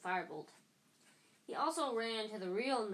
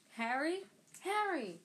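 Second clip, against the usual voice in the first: raised, high call to a friend; yelling his name; 7 semitones higher, 5 dB louder, slower than usual. A narrator's reading. Friends spot Harry and yell his name, calling out. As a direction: call out across the street, pitch jumping up. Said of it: "when someone yelled his name".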